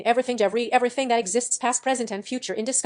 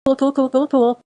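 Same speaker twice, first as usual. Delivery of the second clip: louder, rapid string of cools, lightly voiced; a fan imitating Abed, repeating cool louder; Abed's catchphrase; 5 semitones higher, 6 dB louder than usual. Fan quoting Abed from Community, louder cool cool cool. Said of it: "Jake Peralta says that too".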